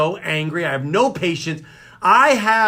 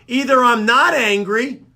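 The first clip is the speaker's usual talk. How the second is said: high, indignant retort; mock angry; 6 semitones higher, 5 dB louder than usual. Dad answers his daughter's verdict, comically angry. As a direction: protest in an agitated, raised pitch. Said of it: "or I'm angry".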